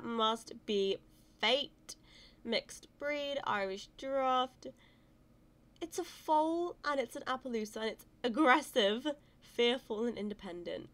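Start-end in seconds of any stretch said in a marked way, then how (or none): none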